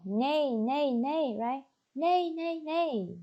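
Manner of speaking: imitating a horse neigh. Shown as a demonstration. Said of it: "And this is sound".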